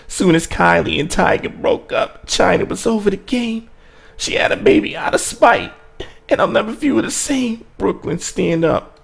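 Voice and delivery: high-pitched rapper impression; put-on rap voice held high through each bar. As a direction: impersonate a famous rapper performing the verse. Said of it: "say it in [name]'s voice".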